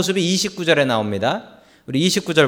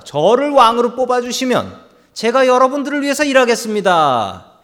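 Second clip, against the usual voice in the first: higher, louder eager campaign promise; campaigning Abimelech; 8 semitones higher, 4 dB louder than usual. Acted. Abimelech courting the Shechem people: higher, louder pleading promise. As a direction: pitch yourself to the voters, voice raised.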